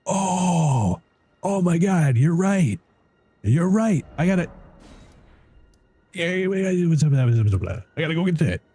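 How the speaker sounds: suddenly thrilled by the tip; real excitement at the discovery; excited realization. Real, not acted. Realizes death spell location.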